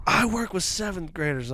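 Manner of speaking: high-pitched indignant comeback; imitated retort. Dude's wife answering the toddler remark, imitated retort.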